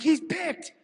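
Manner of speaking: high, urgent alarm on the headset; alarmed warning. Retold or imitated. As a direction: sound the alarm that he's picked. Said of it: "I'm like".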